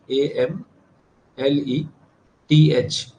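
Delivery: letters spelled out slowly in small groups; slow spelling-out. Real, not acted. Teacher spelling an anagram of Hamlet for students, slow spelling-out.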